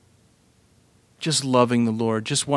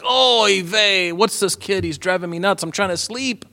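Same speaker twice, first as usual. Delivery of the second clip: put-on weary old man, Yiddish accent; 7 semitones higher than usual. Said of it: "He's like".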